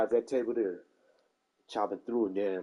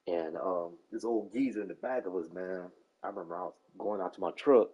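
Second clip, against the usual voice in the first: ordinary talk, nothing marked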